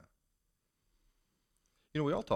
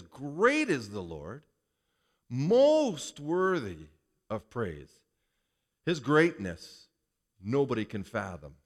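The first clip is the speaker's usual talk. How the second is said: slow scripture reading; 4 dB louder, slower than usual. Acted. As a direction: stretch each phrase out slowly and reverently. Reads the psalm aloud.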